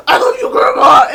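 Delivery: put-on loud, harsh little-kid shout; imitated child's aggressive yell; mock mean. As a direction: shout affection as if it were a threat. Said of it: "this really mean way".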